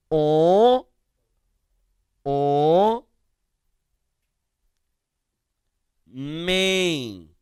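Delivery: "O, o, men" held long, with pauses between; sounding out syllables. Shown as a demonstration. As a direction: sound out "men" slowly, stretching the nasal ending. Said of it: "Vejam como que eu escreveria esta palavra". ("O, o, men" pronunciado devagar, sílaba por sílaba)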